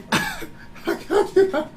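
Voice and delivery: a sudden high laugh that bursts out; real laugh, pitched high; high burst of laughter. Real, not acted. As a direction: crack up at the absurd verse. Laughs at the Judas verse.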